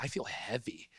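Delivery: whispered, weighed down. Retold or imitated. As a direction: breathe it out as a whisper. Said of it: "I'm like".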